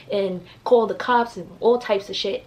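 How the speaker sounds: ordinary talk, nothing marked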